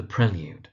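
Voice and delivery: Prelude said PREL-yood; short "e" in Prelude, then "yood"; correct pronunciation. Shown as a demonstration. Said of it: "most frequent mispronunciation is actually the poem".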